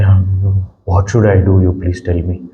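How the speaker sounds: low, subdued plea for advice; lightly acted helpless asking, pitched well down; imitating troubled youngster. Youngster asking the counselor for help, subdued imitated plea.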